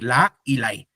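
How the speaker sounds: ordinary delivery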